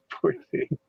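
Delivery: high laughing pity for the dog. Real, not acted.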